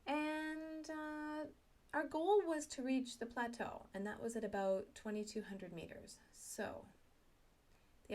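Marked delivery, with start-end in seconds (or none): none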